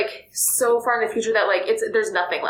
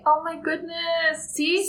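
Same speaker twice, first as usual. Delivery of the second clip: higher, drawn-out slowly, amazed; real amazement; 8 semitones higher, much slower than usual. Real, not acted. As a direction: let the wonder slow the words down. Hears hopeful news from friend.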